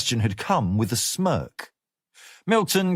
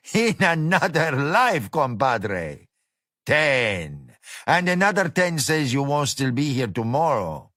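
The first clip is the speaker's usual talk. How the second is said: boorish cook boss; 3 semitones higher than usual. Acted. Gomez voiced by narrator.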